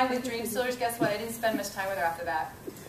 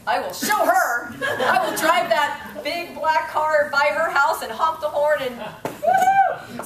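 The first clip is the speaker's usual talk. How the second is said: louder, slow, laughing gloat ending in a high woo; mock vengeful glee; 6 semitones higher, 7 dB louder, slower than usual. Vowing to show up her friend, laughing triumphant whoop. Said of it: "turned into just anger".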